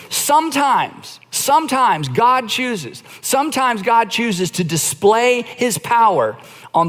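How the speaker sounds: slow emphatic build. Real, not acted. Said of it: "repeats the key word".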